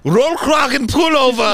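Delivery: Rollkragenpullover barked loud and high; mock angry German. After a joke that German sounds angry, mock angry shout.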